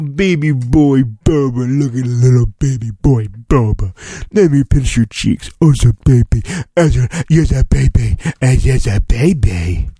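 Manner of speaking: put-on lightly cooed baby talk; mock baby talk. Pinching Boba's cheeks in playful mock baby talk. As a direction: coo at him like a grandma, half joking. Cooing over baby Boba picture.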